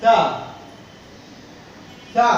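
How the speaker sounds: থা said with the tip of the tongue, aspirated. Shown as a demonstration. Teacher shows students how to say থা, aspirated. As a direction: push air on থা off the tongue tip. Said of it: "কিভাবে বলছি দেখো তো".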